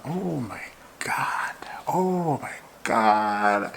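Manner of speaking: slow, breathless whispered oh my God; awed whisper. Real, not acted. Fan imagining signing the keeper, awed whisper. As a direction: whisper it in awe of the dream signing.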